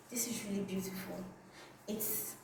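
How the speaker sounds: breathless unvoiced whisper, fast. Shown as a demonstration. Admiring the metallic scarf to viewers, whispered. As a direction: hush it like a secret compliment. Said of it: "So this is really beautiful".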